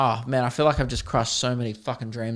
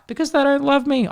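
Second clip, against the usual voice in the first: put-on much higher small child voice; sad kid voice; 12 semitones higher, 3 dB louder than usual. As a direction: answer like a neglected little kid. Covid kid answers teacher.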